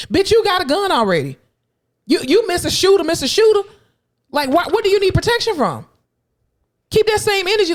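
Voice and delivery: high-pitched, incredulous rant; mock indignation. Speaker taunting the officer who wants protection, indignant. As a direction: go high and indignant, challenging them.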